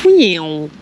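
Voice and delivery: loud falling "meow" whoosh; vocal whoosh as the line flies out; casting sound effect. Pretend. Angler making noises as he casts, playful sound effect. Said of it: "Sometimes I just make sound effects".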